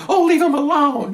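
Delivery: very high, drawn-out mock plea; protesting bystander. Bystander objecting to police arrest, mocking high protest. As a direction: mimic a clueless onlooker, slow and high. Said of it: "of course you hear the, the proverbial".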